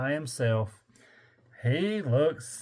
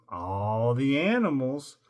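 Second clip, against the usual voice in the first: plain reading voice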